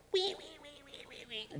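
high squeals fading away; squealing pigs. Acted. Preacher imitating the pigs as they rush off, fading squeals. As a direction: squeal like pigs running off a cliff.

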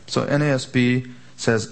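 ordinary talk

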